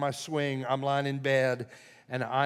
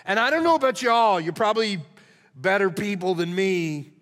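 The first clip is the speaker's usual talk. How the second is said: higher and louder preaching build; full-force preaching; 4 semitones higher, 6 dB louder than usual. Real, not acted. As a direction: preach it with rising passion.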